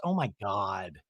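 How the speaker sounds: low, quiet quoted groan of words; retold appalled daughter. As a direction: drop low and soft in mock dismay. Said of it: "And Adeline goes".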